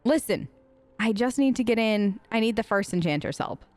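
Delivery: normal talking voice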